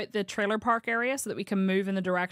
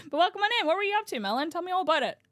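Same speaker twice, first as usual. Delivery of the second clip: much higher, bright welcoming voice; excited welcome; 11 semitones higher, faster than usual. Real, not acted. Streamer welcoming a raider, excited. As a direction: greet them with delight.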